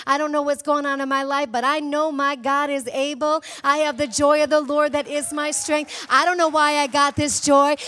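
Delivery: stirring pulpit declaration; preaching declarations. Acted. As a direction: proclaim each line with conviction. Confident declarations of faith.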